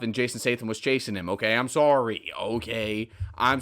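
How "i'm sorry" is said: ordinary talk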